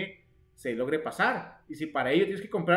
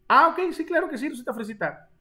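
high and loud, overdone yes-of-course; mock eager agreement; 9 semitones higher, 4 dB louder than usual. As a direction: play along with a mocking, sing-song yes. Teacher answers a chat comment. (respondiendo al chat con un sí burlón y exagerado)

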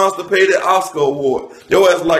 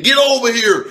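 higher-pitched mock fighter's battle call; Scorpion; 5 semitones higher than usual. Roast comic mimics a game fighter throwing clippers, mock battle call. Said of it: "Look like Scorpion threw the clippers".

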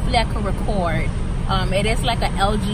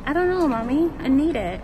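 put-on childlike whine; 6 semitones higher than usual. Whining to her mom.

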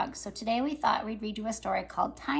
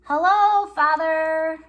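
eager greeting; 4 semitones higher, 10 dB louder, much slower than usual. Acted. Tiny greets his father.

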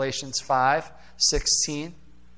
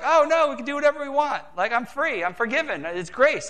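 mock careless believer; 8 semitones higher than usual. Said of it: "that's what we're like".

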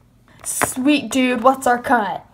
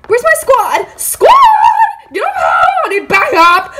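high, loud frantic yell; calling for backup; 10 semitones higher, 5 dB louder than usual. Acted. Girl summons her squad against a peasant, frantic shouting.

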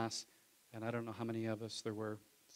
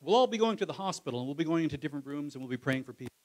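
imitating the pastor; 3 semitones higher, 8 dB louder, faster than usual. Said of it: "and the pastor says".

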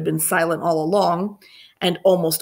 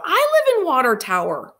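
eager; 10 semitones higher, 3 dB louder than usual. A narrator's reading. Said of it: "Chelsea said aloud".

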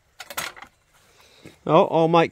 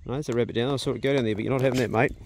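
ordinary talk

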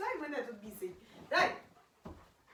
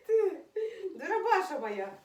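high giggling through the words; can't stop laughing at the pet; 6 semitones higher than usual. Real, not acted. Laughs at pet's antics. (крізь сміх, високо)

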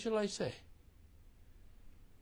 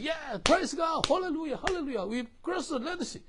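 high, loud, jubilant cheering of praise; joyful shouts of hallelujah; excited crowd; 11 semitones higher, 6 dB louder than usual. Retold or imitated. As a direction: burst out like a rejoicing crowd. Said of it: "They are so excited".

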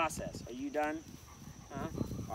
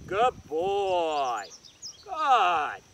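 dog praise; 6 dB louder, much slower than usual. Real, not acted. Praising dog after retrieve.

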